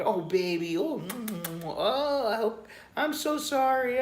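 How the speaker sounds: very high, gushing sympathy; hammed-up fussing over a hurt partner; imitating cooing woman. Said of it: "some Western women".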